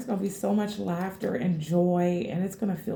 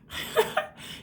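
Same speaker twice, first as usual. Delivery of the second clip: high giggle; 4 dB quieter than usual. Real, not acted. Amazed at the cards.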